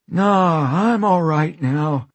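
high, wheezy grin; grinning and wheezing. Acted. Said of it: "grinning and wheezing".

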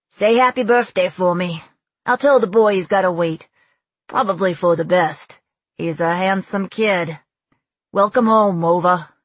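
loud, friendly Brooklyn officer signing off; Brooklyn accent. A narrator's reading. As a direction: sign off heartily in Brooklyn accent. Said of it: "The accent was pure Brooklyn".

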